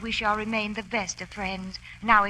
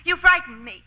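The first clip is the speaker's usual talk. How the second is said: higher and louder with sudden alarm; startled and scared; a woman's alarmed cry to a creeping man; frightened; 8 semitones higher, 7 dB louder than usual. Acted.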